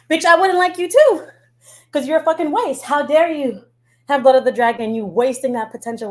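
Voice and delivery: high, loud indignant rant; mock angry rant. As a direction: tear into her, outraged.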